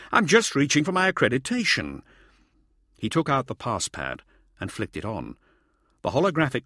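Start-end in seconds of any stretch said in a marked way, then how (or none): 0.1-2.0 high-pitched hasty reassurance; alarmed cry. A narrator's reading. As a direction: explain urgently, pitch well up. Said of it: "Hauser cried".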